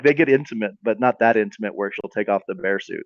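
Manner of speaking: ordinary talk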